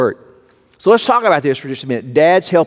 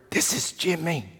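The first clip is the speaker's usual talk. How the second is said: put-on much softer, small-boy voice; hushed little boy; 12 dB quieter than usual. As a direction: answer like a shy four-year-old on the phone.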